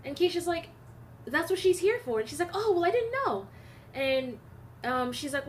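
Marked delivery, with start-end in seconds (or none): 1.2-2.2 higher, pointed retort. Retold or imitated. Keisha retorts to the lady. 2.5-3.5 much higher, louder, defensive; defensive reply. Retold or imitated.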